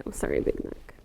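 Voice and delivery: soft whisper to the duck; whispered apology. Real, not acted. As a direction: whisper to the pet.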